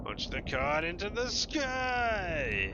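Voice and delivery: high, slow and loud herald cry; grand proclamation. Acted. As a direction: proclaim it like a town crier. Reading a grand game command.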